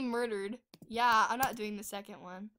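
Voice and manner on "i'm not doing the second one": usual speaking voice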